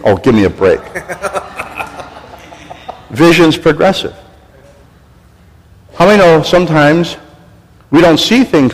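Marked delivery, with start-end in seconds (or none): 0.0-3.1 laughing. Real, not acted. Laughing aside at own joke.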